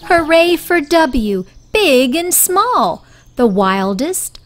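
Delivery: plain reading voice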